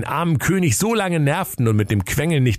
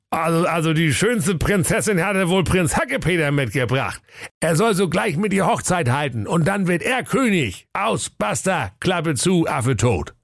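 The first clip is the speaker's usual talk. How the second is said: put-on grumpy old king; 7 semitones higher than usual. King decides.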